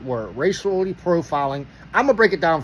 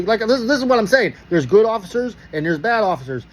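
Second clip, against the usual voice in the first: usual speaking voice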